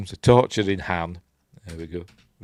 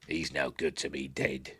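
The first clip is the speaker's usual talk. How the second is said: low, muted bounty hunter voice; quiet, low character voice; bounty hunter impression; 6 semitones lower, 10 dB quieter than usual. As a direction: try out a low villain's line. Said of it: "can't remember what his voice is like".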